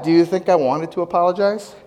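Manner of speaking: ordinary talk, nothing marked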